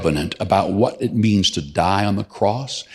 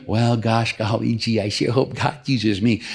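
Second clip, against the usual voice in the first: gee-whiz timid believer voice; meek aw-shucks mock piety; wholesome 1950s kid. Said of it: "that's not humility, that's stupidity".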